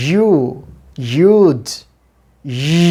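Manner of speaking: you, you'd with buzzing y and long oo; slow, emphatic y in you, you'd, ই; model pronunciation. Shown as a demonstration. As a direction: isolate ই as a frictional consonant after you'd. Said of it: "তো pronunciation এভাবে হবে".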